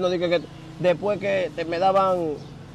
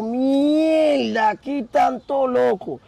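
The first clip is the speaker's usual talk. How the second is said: higher-pitched, drawn-out retold shock; acted dismay; 6 semitones higher, much slower than usual. New prisoner retelling his reaction to the inmates, acted dismay. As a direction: react aghast, stretching the words.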